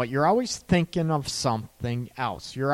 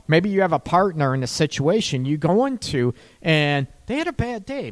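usual speaking voice

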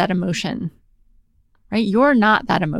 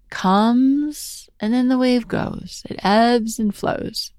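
slow and higher, stretching each word like a rolling wave; slow, swelling delivery; drawn-out wave; 4 semitones higher, slower than usual. Shown as a demonstration. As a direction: let the words rise and fall slowly. Teacher draws out the wave image.

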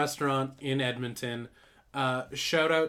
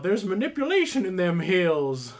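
high, loud yeehaw prospector; hillbilly gold panner; 3 semitones higher, 6 dB louder than usual. Acted. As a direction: holler like an old gold miner. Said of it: "a panning for gold hillbilly man".

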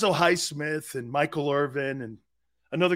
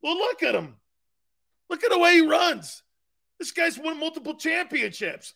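high-pitched insistent fan raving; retold gushing praise; 11 semitones higher than usual. Grandfather defending his new favorite player, high excited gushing. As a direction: push the pitch way up, marvelling. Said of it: "He's like".

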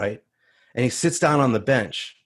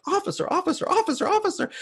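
very high-pitched panicky echo; panicked inner voice, comically high; freaking out; faster than usual. Retold or imitated. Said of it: "Like, I'm freaking out".

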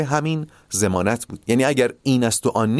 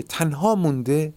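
ordinary talk

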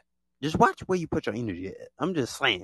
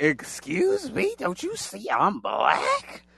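indignant excuse me; 8 semitones higher, much slower than usual. Retold or imitated. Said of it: "it's like".

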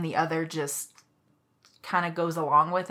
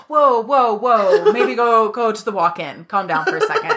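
louder, higher alarmed soothing; coworker calming; 4 semitones higher, 6 dB louder than usual. Retold or imitated. Coworkers calming the furious server, laughing alarm. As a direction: ease her down, half laughing. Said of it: "they were like".

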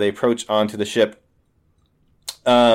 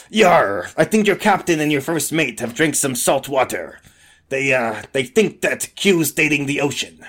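salty pirate voice; pirate diplomat; 2 semitones higher than usual. Acted. Pirate turns to Arthur about Q, pirate voice. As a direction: confide it as a puzzled sea dog. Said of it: "and be like".

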